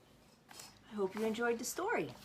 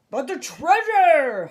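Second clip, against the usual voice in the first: slow, higher and louder anguished holler; captain hollering; 8 semitones higher, 15 dB louder, slower than usual. A narrator's reading. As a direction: wail for the lost treasure. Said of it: "hollered Braidbeard".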